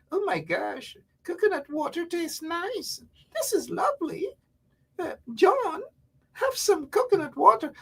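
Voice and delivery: very high, soft, swooning voice; teasing impression of a homesick Guyanese back home; mock gushing expat. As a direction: play a delighted visitor sipping coconut water. Mocking expats gushing over coconut.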